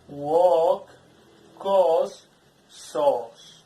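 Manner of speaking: loud "walk", "cause", "sauce" with the aw vowel stretched long; long vowel demo. Shown as a demonstration. Teacher showing the long aw vowel to students, loud. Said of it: "long sound".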